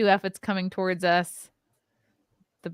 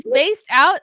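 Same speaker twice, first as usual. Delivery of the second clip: higher, louder disbelief; incredulous; 6 semitones higher, 8 dB louder than usual. Real, not acted. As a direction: echo it in disbelief.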